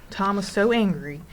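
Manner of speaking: usual speaking voice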